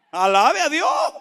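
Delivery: slow, high shouted call to praise; exhortación a gritos. Real, not acted. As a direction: shout it out, drawn out and rising at the end. Urges congregation, louder repeat. (grito alto y alargado de exhortación)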